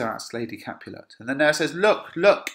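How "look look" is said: urgent cry. Acted. Nurse shows the dead girl.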